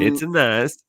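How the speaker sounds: high, loud Borat impression; Borat accent. Imitating Borat catchphrase.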